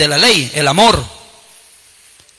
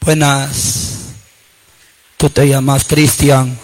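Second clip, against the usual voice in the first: low, slow Grim Reaper voice; deep, unhurried bony visitor; Death come calling; 8 semitones lower, slower than usual. Acted. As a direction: greet them like Death at the door at night. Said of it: "le toca la huesuda".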